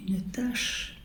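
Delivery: breathy dramatic whisper. Real, not acted. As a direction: drop to a whisper for the stain. Dramatic reveal of the stain. (chuchoté, dramatique)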